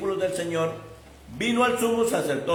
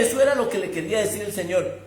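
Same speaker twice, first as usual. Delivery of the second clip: ordinary talk, nothing marked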